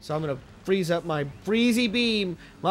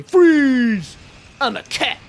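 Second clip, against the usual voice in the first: loud high "Freeze!" like a cartoon cop; mock cop shout; 6 semitones higher, 9 dB louder, much slower than usual. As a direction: bark it like a cop on a bust. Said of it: "I say".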